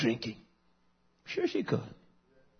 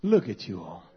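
ordinary talk, nothing marked

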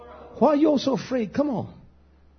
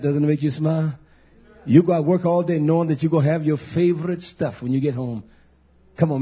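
intimate savoring; 9 semitones lower than usual. Acted. Pastor relishing favorite meal image.

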